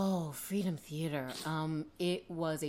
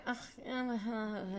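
reluctant groan; 7 semitones higher than usual. Retold or imitated. Parents reluctant response.

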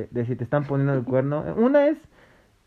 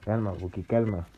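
tranquilizador; 6 semitones lower, 7 dB quieter than usual. Real, not acted. Calma al amigo asustado.

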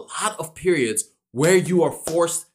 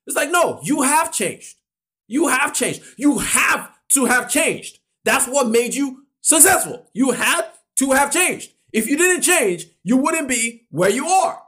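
firm, raised-voice correction; emphatic insistence; 6 semitones higher than usual. Acted. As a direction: hammer the point that you changed.

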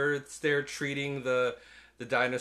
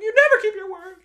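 much higher, much louder; mock shrill accusation; 15 dB louder than usual. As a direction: shriek it like a jilted partner. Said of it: "It's like".

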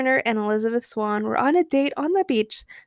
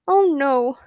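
ordinary delivery

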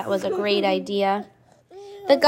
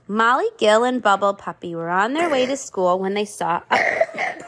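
ordinary delivery

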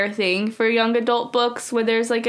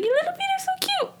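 high squeaky mocking voice; mocking creepy admirer; 4 dB quieter, faster than usual. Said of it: "he's like".

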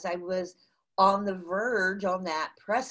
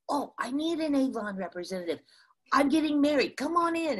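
high, quick excited invitation; eager welcome, retold; 7 semitones higher, faster than usual. Young bride-to-be to Avon rep at door, eager welcome. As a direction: imitate a thrilled customer rushing to invite someone in.

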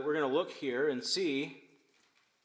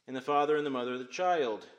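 ordinary talk, nothing marked

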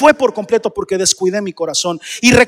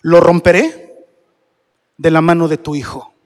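solemn pronouncement; 5 semitones lower than usual. Acted. Reads God's verdict to Solomon. (sentencia solemne y pausada)

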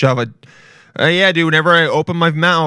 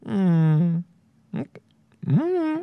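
rising high suspicious hum, quiet; suspicious humming while watching a new coworker; skeptical hum; 6 semitones higher, 6 dB quieter than usual. Retold or imitated. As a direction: hum like the monocle emoji. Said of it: "you're like".

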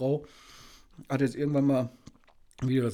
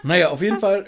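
laughing; 5 semitones higher, 11 dB louder than usual. Real, not acted. Laughs after she teases him.

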